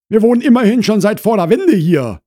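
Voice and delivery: high, loud, quick; Frau Krause asserting. Acted. Wife tells newcomer of their long residence, insistent. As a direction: assert your standing loudly.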